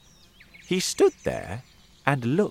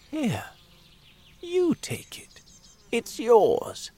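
put-on whispering old man; 13 semitones higher, slower than usual. Old man pushes bag urgently.

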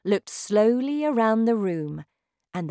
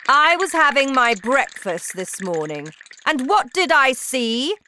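shouting witch; 8 semitones higher, 9 dB louder than usual. Acted. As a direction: bellow like a terrifying witch queen. Grand High Witch rants.

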